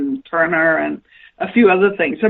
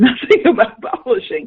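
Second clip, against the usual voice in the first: laughing; 6 semitones higher, 4 dB louder than usual. Real, not acted. Admitting ignorance, laughing.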